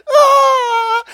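very high, loud sobbing cry; hammed-up wailing cry. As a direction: burst into a mock sob. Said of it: "she's like".

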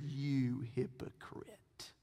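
slow, low whispered accusation; quiet, drawn-out reproach; hushed rebuke. Acted. Voices Jesus rebuking the official.

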